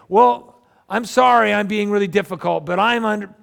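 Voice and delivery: loud, high, indignant mock apology; defensive non-apology. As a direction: push the excuse out forcefully over any objection.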